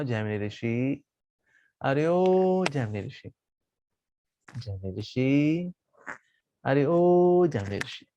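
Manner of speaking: drawn-out, slow summons; slow calling out. Acted. Vyasa slowly calling out to the caught Jaimini. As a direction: call his name slowly, savouring it.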